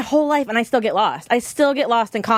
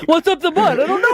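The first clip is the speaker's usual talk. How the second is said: imitating Tom; 7 semitones higher, 3 dB louder than usual. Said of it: "Tom's like".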